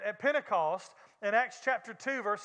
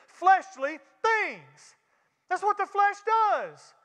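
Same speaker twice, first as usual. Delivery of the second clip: raised, driving preacher's voice, slowing at the end; preaching at full force; 5 semitones higher, 4 dB louder than usual. Acted. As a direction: hammer home the warning to the church. Preaching hard on flesh versus spirit.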